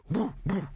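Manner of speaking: high woo woo dog sound; dog howl imitation. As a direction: mimic a howling dog.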